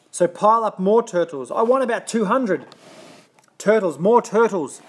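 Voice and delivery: high, loud, greedy bellow. A narrator's reading. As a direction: roar the demand at the pond. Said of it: "he bellowed and brayed".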